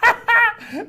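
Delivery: high-pitched burst of laughter; short, high giggling laugh. Real, not acted. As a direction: break into a high cackling laugh. Teacher laughs at his own trick.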